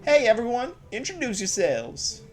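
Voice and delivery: a hint of a southern lady greeting Link; southern accent. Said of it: "attempt to do, like, a southern accent with a female voice".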